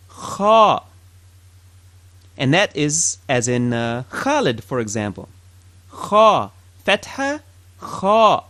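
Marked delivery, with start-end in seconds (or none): none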